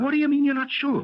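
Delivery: can't believe what he's hearing; incredulous. Acted. Detective grilling his suspect, incredulous and shrill. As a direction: pitch jumps up in outraged disbelief.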